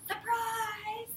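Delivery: spring the surprise on her; cheerful cry. Acted. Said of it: "they cried".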